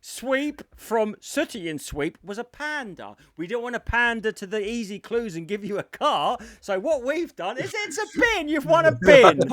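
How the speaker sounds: mock host, building to a high shout; hammed-up prize reveal. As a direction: build the absurd logic into a yelled punchline. Parodying host's absurd prize reveal.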